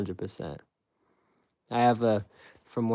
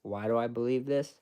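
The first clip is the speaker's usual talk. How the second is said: ordinary delivery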